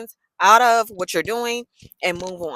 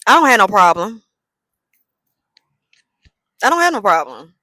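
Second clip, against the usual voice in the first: louder defiant repeat; defiant louder take; mock insistence; 7 dB louder than usual. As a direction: insist you have no problem, louder. Repeating the phrase, trying tones.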